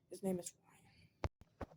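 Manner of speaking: slow unvoiced whisper; a secret breathed out slowly. Real, not acted. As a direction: let the name slip out in a hush. Reveals secret name.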